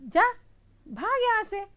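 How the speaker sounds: high, loud brush-off; shooing seller. Acted. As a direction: drive the mouse away. Lace seller chases mouse off. (ऊँची, डाँटती आवाज़)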